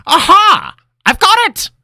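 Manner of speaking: high, loud triumphant cry; excited eureka. Acted. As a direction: burst out with the idea. Linram's sudden idea.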